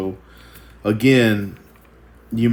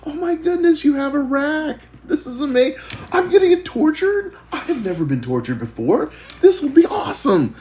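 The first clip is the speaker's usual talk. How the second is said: very high, gushing mock delight; thrilled about the torture rack; hammed-up cheery victim, far higher; upbeat movie guy; 5 dB louder than usual. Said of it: "he's like".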